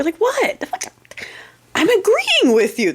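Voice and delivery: mock innocence. Trolling friends by acting baffled they're upset, in a high squeal. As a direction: act shocked they're mad at agreement.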